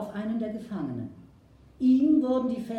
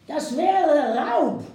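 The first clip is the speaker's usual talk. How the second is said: slow, loud, high outraged cry; loud slow outraged chief; 7 semitones higher, 9 dB louder, much slower than usual. Acted. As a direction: hurl the accusation slowly and loudly. Chief outraged at horse theft.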